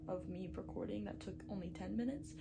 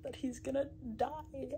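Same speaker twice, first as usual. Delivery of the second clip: ordinary talk, nothing marked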